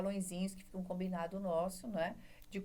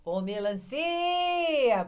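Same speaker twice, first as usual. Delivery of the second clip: "melancia" called out, CI held very long, high and loud; calling the word; 8 semitones higher, 7 dB louder, much slower than usual. Acted. Pupil calls the word after the magic O, CI stretched high. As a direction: holler "melancia" with an endless CI. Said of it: "chama a palavra".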